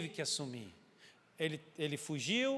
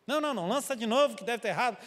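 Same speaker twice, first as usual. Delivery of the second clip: higher, louder, fast protest; frantic denial; 5 semitones higher, 6 dB louder, faster than usual. Retold or imitated. Sailors insisting the lot was wrong, fast and agitated. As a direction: refuse the result in a hurry.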